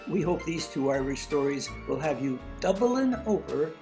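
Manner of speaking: normal talking voice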